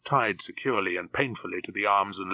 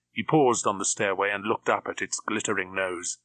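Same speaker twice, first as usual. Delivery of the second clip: plain reading voice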